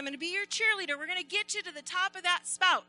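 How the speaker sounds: eager cheer. Acted. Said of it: "And Ladybug said".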